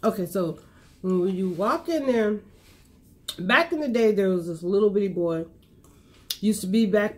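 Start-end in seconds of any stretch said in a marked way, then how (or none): none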